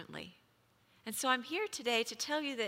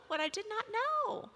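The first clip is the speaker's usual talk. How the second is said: repeated much higher for emphasis; what I did not know pitched high and stressed; emphatic high repeat; 12 semitones higher than usual. Shown as a demonstration. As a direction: lift the whole phrase way up.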